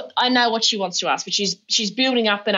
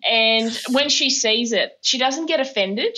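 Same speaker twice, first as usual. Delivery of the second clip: ordinary talk, nothing marked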